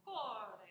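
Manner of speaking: "Core" as "KO-reh", loud on the first part; heavy first syllable. Shown as a demonstration. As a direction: land heavily on the start of "Core". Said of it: "heavy on the first syllable".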